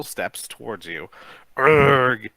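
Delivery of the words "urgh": loud guttural zombie groan; zombie moan. A narrator's reading. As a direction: moan like the walking dead. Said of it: "it moans".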